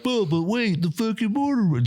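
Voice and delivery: high, whiny mock hesitation; mocking hesitant teammate. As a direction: parody the timid objection.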